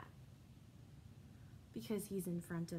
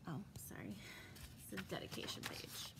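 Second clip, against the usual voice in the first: whispered aside; 4 semitones lower, 9 dB quieter than usual. Real, not acted. Aside while turning the page.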